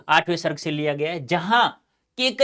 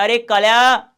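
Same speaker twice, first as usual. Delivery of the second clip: loud, high hail to a friend; calling out; 8 semitones higher, 12 dB louder, slower than usual. Acted. One friend calling Kalya at his house site, hollering. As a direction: holler the name with raised pitch.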